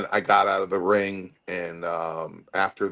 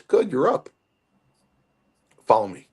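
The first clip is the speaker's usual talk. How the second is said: higher, louder older-brother command, lightly acted; brother's brisk order; 5 semitones higher, 4 dB louder than usual. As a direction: bark it like the bossy older brother waking you. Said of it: "my brother goes".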